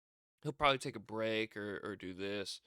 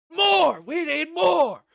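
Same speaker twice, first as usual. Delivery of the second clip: very high, very loud demanding yell, slowing on the second line; mock frenzied scream; 14 dB louder, much slower than usual. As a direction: scream for more violence, hammed-up. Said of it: "Jey goes".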